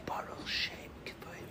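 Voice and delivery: unvoiced whisper of the blessing. Shown as a demonstration. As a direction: hush it like a secret prayer. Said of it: "Why don't we whisper it?".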